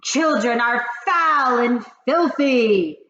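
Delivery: loud slow witch thunder. Acted. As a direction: boom it out slowly. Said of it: "thundered the Grand High Witch".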